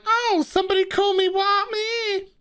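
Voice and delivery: very high, affronted squeak; mock offended squeal. Mocking the insulted engineer with a very high squeal.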